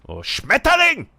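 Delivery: Schmetterling shouted high and loud; angry German. Shown as a demonstration. Proving German only sounds right shouted angrily. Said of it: "then I'll say it angrily".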